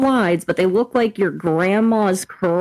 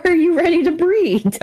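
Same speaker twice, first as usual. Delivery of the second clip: mock bold question; 10 semitones higher than usual. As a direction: push the outrageous joke. Joking escalation.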